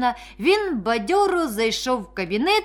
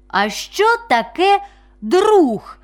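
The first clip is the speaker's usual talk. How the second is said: put-on high, louder, slow childlike question; little fawn; 7 semitones higher, 5 dB louder, slower than usual. A narrator's reading. The newborn fawn asks the oak, high and curious. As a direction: ask wide-eyed, stretching the words up high. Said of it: "запитало оленятко".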